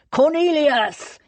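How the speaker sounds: high, drawn-out bellow; roar. A narrator's reading. Thomas wanting to find the villain, roaring. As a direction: bellow the name at full stretch. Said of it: "he roared again".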